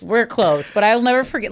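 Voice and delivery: ordinary delivery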